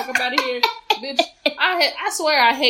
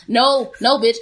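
normal talking voice

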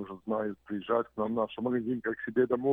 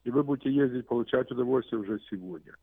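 ordinary delivery

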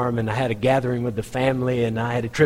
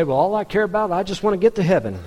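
higher-pitched mock excuse; complacent churchgoer; 7 semitones higher than usual. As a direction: lightly mimic someone shrugging off the details.

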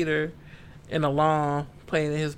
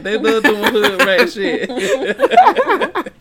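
slow, loud, laughing through the words; cracking up mid-sentence; real laughter; 8 semitones higher, 12 dB louder, much slower than usual. Real, not acted. Joking to co-hosts about freed dwarves, laughing loudly.

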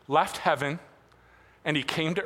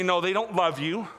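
normal talking voice

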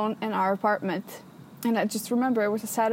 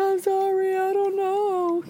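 higher, small apologetic voice; meek younger self; 8 semitones higher, slower than usual. Retold or imitated. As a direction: play yourself helpless and sorry. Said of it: "and I was like".

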